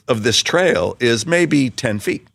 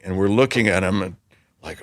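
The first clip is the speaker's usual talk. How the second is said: ordinary delivery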